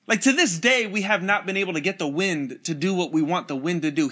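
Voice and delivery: ordinary delivery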